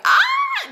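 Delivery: very high squeal of joy; happy squeal. Retold or imitated. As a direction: let out a delighted scream. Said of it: "I'm so happy".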